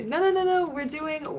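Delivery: quick high no no no; eager correction. Real, not acted. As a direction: jump in to correct them.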